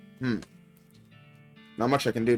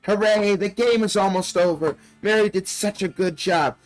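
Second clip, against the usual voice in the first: talking doll; 6 semitones higher than usual. Acted. Doll speaks in story.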